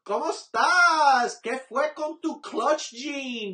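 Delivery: loud, slow taunting Spanish; mock taunt. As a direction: tease the player in Spanish.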